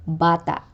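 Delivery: bata with stressed ba-, abrupt catch after the final a; penultimate glottal stress. Shown as a demonstration. Teacher repeating the example, bata stressed first with glottal catch. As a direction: stress the start of bata, cut the end off. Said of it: "pronounced with a glottal catch".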